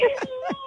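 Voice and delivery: quick breathy giggle. Real, not acted. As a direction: laugh it off. Guest laughs after interruption.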